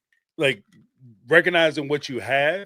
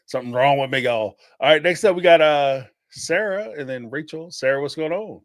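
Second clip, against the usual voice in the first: ordinary talk, nothing marked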